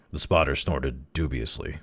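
ordinary delivery

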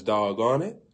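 usual speaking voice

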